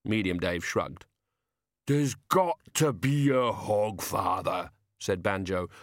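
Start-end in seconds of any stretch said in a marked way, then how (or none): 1.8-4.7 very slow, lumbering character voice; big simple man, stubbornly insistent; slow-witted giant. A narrator's reading.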